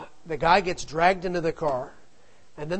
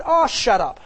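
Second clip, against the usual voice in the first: higher, louder dismissive snap; mother telling off; 6 semitones higher, 8 dB louder than usual. Retold or imitated. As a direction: brush them off sharply. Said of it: "And my mother said".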